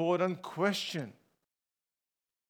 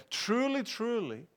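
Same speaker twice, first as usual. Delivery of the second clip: "Truly, truly" with the first word lifted high, the second falling; emphatic repetition; 4 semitones higher, 5 dB quieter than usual. Acted. Preacher quoting Jesus on something very important, "Truly, truly" said emphatically. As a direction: lift the first "Truly", let the second "truly" drop softer. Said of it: "There is an emphasis here".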